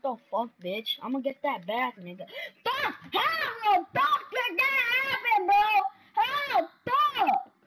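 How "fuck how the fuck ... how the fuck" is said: much higher, loud angry outburst; real gamer rage; raging. Real, not acted. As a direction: rage at the game. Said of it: "It's not that I'm raging".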